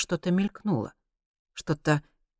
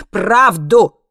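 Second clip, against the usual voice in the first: loud, raised one-word demand; shouted demand; 6 semitones higher, 12 dB louder than usual. A narrator's reading. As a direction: fire it back as a shout. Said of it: "ответил Хайман". (громкое требование)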